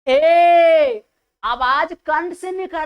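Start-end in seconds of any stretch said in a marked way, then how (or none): 0.1-1.0 loud, high, held ए from the throat; loud demo ए. Shown as a demonstration. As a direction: belt out a long ए. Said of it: "बोल कर देखिए". (ज़ोर से खिंचा हुआ ए)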